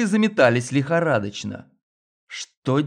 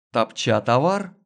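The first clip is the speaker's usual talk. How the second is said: plain reading voice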